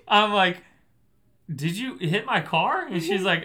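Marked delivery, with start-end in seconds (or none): 1.5-2.9 loud, higher, slowed-down accusing question; retold indignant challenge. Driver recounting confronting the woman, indignant challenge. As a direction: confront her, stretching the question out.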